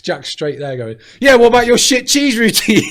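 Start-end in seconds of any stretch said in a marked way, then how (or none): 1.2-2.8 much higher and louder, jeering; loud mocking impression of a heckler; mocking heckle. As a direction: take the piss like a smug heckler. Imitating Jack's heckle at Craig.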